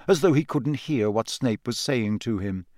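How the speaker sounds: plain reading voice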